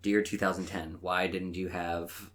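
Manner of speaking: usual speaking voice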